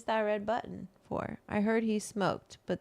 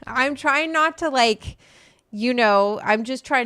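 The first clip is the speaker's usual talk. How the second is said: laughing; 5 semitones higher, 8 dB louder than usual. Real, not acted. Laughing apology over slang pronunciation.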